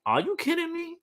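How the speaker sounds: high mock disbelief; mock outraged disbelief. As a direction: squeak it out in disbelief. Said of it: "She was like".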